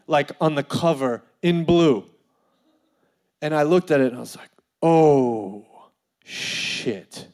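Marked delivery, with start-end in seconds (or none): 4.8-7.3 long drawn-out oh, then breathy curse; retold slow realisation, voice dropping away; dawning dread. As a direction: let it sink to a low whispered curse. Finds incriminating slogans in own book.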